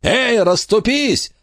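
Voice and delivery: high, slow booming call to the crowd. A narrator's reading. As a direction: bellow at the crowd to part. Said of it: "раздался зычный голос". (зычный окрик)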